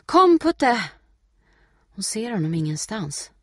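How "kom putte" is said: high, loud summons; calling out. A narrator's reading. Steffi calling her runaway dog, calling out. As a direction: call the dog back across a distance. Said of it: "ropar hon".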